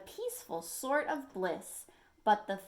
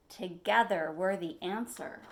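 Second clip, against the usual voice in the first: ordinary delivery, nothing marked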